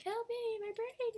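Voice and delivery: very high, loud cry for help; desperate scream. Acted. Robot begging for help as pop-ups fill its brain, screaming. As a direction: shriek as if your head is overloading.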